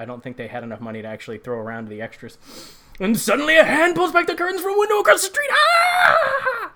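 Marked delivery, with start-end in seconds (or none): 3.0-5.5 higher, louder, rushed like a jump scare; dramatic horror narration. Acted. Recapping the movie for viewers, a hammed-up horror announcer. 5.5-6.7 high sudden hammed-up scream; mock scream. Mocking the movie's jump scare, a high loud fake scream.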